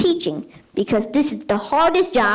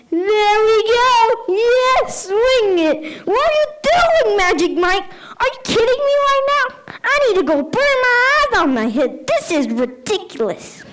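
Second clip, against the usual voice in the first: mock outraged teacher; 9 semitones higher than usual. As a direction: shriek at the dancing kid in mock horror. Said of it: "starts leaning into it like".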